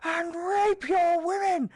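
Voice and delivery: high, slow, strange put-on villain voice; mock war cry. Retelling the game, mock threat in high put-on voice. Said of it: "in Penguinese".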